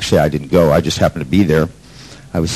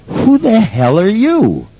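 mock challenge; 14 semitones higher, slower than usual. Challenging the woman in the aisle.

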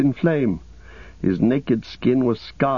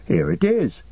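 higher, startled; 9 semitones higher than usual. A narrator's reading. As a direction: caught off guard. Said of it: "rather startled".

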